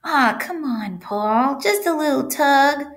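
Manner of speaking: high, wheedling little voice; urging pigtail. A narrator's reading. Pigtail urging the boy to tug, wheedling. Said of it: "urged the pigtail".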